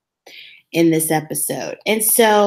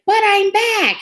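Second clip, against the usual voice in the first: mock high plea; 3 dB louder than usual. Quoting her, high put-on voice.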